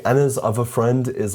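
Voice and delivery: normal talking voice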